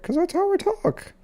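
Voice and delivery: very high cartoon mouse voice, matter-of-fact; squeaky Mickey Mouse. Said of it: "why are you talking like Mickey Mouse".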